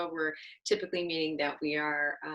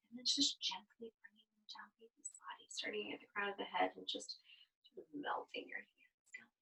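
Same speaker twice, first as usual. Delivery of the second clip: whisper; 9 dB quieter than usual. Acted. Gentle massage guidance.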